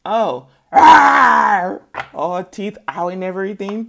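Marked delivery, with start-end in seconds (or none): 0.1-1.8 loud mock roar; lion roar. Lion roaring back at the owner, loud mock roar. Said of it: "that lion look at you like".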